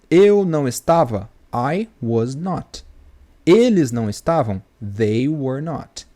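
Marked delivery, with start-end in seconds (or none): 1.5-2.8 each word laid out slowly for students; teacher spelling out I was not slowly; slow model sentence. Shown as a demonstration. Teacher models the negative for students, slow and deliberate. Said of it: "se eu vou falar assim". 4.8-6.1 each word laid out slowly for students; teacher spelling out I was not slowly; slow model sentence. Shown as a demonstration. Teacher models the negative for students, slow and deliberate. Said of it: "se eu vou falar assim".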